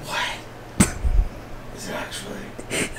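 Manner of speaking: barely voiced, just woken up; groggy whisper. Real, not acted. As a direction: mumble it half asleep.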